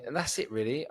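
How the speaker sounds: ordinary talk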